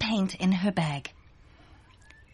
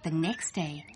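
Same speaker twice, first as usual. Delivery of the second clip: ordinary delivery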